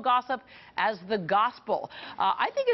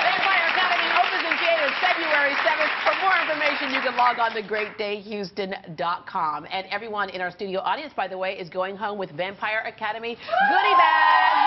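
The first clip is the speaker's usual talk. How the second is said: TV promo read; 2 semitones higher, 4 dB louder than usual. Acted. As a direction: sell the movie to the audience. Closing show promo.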